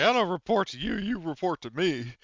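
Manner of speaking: high, loud, smug declaration; mock power play. Game master voicing the petty boss to his subordinate, smugly. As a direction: lord it over him with a grin.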